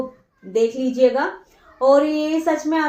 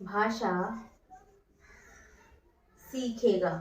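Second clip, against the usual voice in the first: word by word, quieter, long pauses between words; slow dictation; 3 semitones lower, 9 dB quieter, slower than usual. Shown as a demonstration. Teacher dictating exam notes to students, slow word-by-word dictation. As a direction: dictate each word slowly so students can write.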